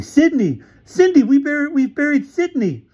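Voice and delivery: high, loud panicked cry; frantic alarm. Acted. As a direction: call out in horror. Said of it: "looked around frantically".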